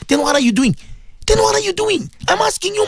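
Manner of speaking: high-pitched, exasperated questioning; indignant challenge. Real, not acted. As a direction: challenge them, pitch leaping up on the repeat. Challenges listeners after low admonition.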